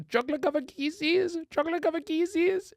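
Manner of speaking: high nasal-free cartoon kid singsong; squeaky cartoon boy, way up high; Cartman voice. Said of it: "a Cartman with".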